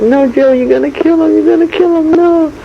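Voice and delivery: high, loud panicked pleading; frantic yell. Retold or imitated. Mother screaming at Joe not to kill him. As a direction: cry out to stop him hurting the boy.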